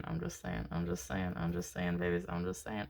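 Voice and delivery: low, soft mock voice; a playful low-voiced tease; deep goofy voice. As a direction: drop way low and mumble it softly. Playful voices on repeated phrase.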